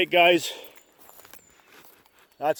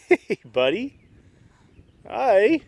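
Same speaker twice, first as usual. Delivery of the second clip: dog talk; 10 semitones higher, 4 dB louder, slower than usual. Real, not acted. Greeting the dog again.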